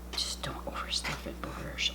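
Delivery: soft whispered aside; hushed whisper while stuffing. Real, not acted. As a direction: whisper it to yourself.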